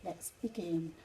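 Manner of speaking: ordinary delivery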